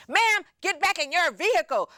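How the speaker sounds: high, commanding cop voice through a bullhorn; officer on the bullhorn ordering her back to her car, commanding. Retold or imitated. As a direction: bark it like a cop on a megaphone. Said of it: "He gets on a bullhorn and scares the hell out of me".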